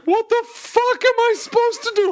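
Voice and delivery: shrieked high in mock panic; frantic high-pitched mock panic; panicked high squeak. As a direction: squeal it like a lost boater. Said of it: "going like".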